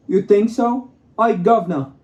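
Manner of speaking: loud, high mock-British voice; hammed-up cockney-style British caricature; British accent. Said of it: "trying to do a British accent".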